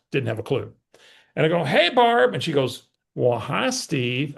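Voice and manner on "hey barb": loud, high phone hello; feigned familiarity. Retold or imitated. Retelling his phone greeting to a barely known caller, feigned familiarity. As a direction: greet like an old pal, pitched right up. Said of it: "Acting like I knew who she was".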